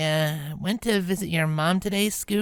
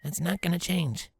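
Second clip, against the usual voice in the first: reassuring; 4 semitones lower than usual. Acted. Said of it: "tried to smile reassuringly".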